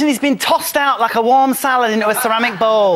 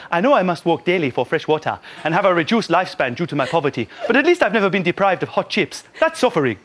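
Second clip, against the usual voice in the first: lower, earnest and dry; lightly voiced sincere sarcasm; mock African woman; 3 semitones lower than usual. Mocking the campmates through a poor woman's voice, lower and dry.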